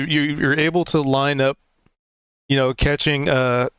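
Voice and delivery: ordinary delivery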